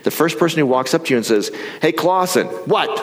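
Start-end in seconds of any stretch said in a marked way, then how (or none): 1.8-2.9 higher, sharp call of a name; mock annoyed coworker calling out; raised, irritated voice. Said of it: "their raised tone of voice".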